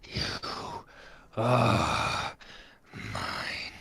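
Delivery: slow whispered monster voice, fading; sinister voiceless rasp; possessing inner voice. Acted. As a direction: breathe out each word with long pauses. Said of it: "the other voice goes".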